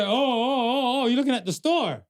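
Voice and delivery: a passerby suddenly getting it; relieved stranger. Retold or imitated. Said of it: "He's like".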